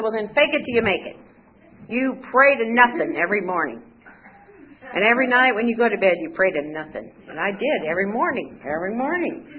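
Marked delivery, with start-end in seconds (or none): 0.0-7.1 louder, higher, exasperated orders; kinda mad. Retold or imitated. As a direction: imitate an annoyed man laying down instructions. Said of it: "he got kinda mad".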